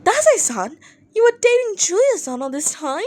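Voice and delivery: high, incredulous shock; a startled junior who can't believe it; lightly voiced stunned younger colleague; shocked fan. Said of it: "At Not Jinko".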